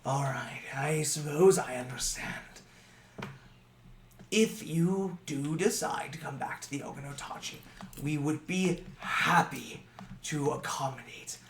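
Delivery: soft, gentle courteous reply; polite soft farewell. Acted. Old goblin politely accepting the refusal, soft and courteous. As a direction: graciously let them go.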